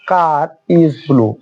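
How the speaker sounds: very slowly, "car" linked into "is" with r; linking r demo. Shown as a demonstration. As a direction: run the r of "car" into "is". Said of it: "আর এর পরে পরবর্তীতে vowel আছে". (খুব ধীরে, "car"-এর আর "is"-এর সাথে জুড়ে)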